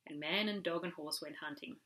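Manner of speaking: usual speaking voice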